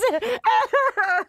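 real high laughing fit; high loud laugh. Real, not acted. Laughing at her story.

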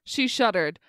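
usual speaking voice

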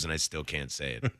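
ordinary talk

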